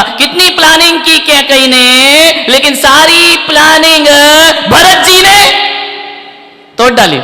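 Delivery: high, loud build to a peak; preaching at full force. Real, not acted. Preacher building to Bharat wrecking the plan, full force. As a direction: stretch it out, then hit the name hard.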